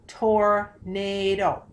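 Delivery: tornado stretched out slowly, syllable by syllable. Shown as a demonstration. Teacher breaking the word for students, slow. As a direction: sound out tornado slowly for the class. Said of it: "Let's try tornado".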